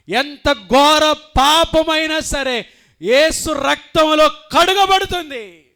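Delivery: loud, high and slow proclamation; preaching at full force. Acted. Preacher proclaiming forgiveness to the congregation, slow and loud. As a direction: drive each word home from the pulpit.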